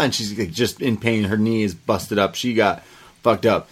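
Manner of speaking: ordinary talk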